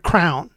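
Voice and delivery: "crown" as one syllable, meaning crayon; wife's mispronunciation. Retold or imitated. Husband explaining how wife says crayon, one-syllable mispronunciation. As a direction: rhyme it with down: "crown". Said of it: "says it just like the word".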